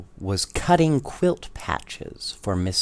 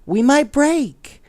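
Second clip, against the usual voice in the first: high, loud fretful doll voice; dolls' worried cry; 6 dB louder than usual. A narrator's reading. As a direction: fragile dolls scared of breaking. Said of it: "cried the dolls".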